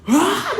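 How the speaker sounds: high, imitated shriek of surprise; comic cry of sudden fright; startled scream. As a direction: yelp like a spooked child. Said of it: "막 놀래면서".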